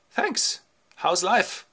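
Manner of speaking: high-pitched guest voice; polite beggar. Acted. Disguised beggar thanking the swineherd, high polite voice.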